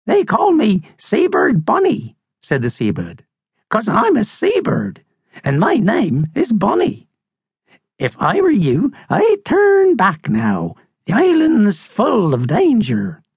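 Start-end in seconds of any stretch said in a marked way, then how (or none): 0.1-2.2 high, swooping bird voice; old crusty seabird. Acted. Seabird introduces herself to the visitors, high and crusty. 3.7-13.2 put-on high, singular old-bird voice; old crusty seabird, warning. Seabird warns visitors the island is dangerous, high and ominous.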